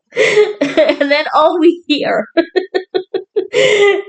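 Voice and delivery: high, slowed words breaking into laughter; giggling while recounting the scene; laughing. Real, not acted.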